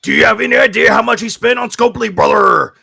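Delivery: rally the crowd, brother; heroic rallying shout. Acted. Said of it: "wrestling promo".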